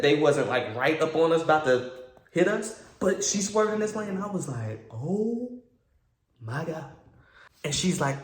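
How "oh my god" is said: high, drawn-out oh, then a pause; stretched-out mock horror; mock alarm. Passenger reacting to her swerve, mock alarm.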